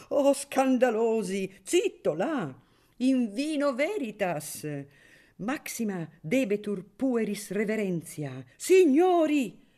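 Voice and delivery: host shouting for silence at the table. A narrator's reading. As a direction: shout the rowdy guests down, hammed up. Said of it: "gridò Filippino".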